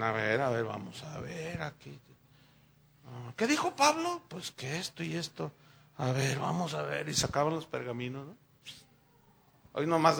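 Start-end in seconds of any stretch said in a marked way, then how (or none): 0.0-7.1 low, soft pondering voice; musing Bereans. Acted. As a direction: mutter as if checking the scrolls. Bereans checking Paul's words.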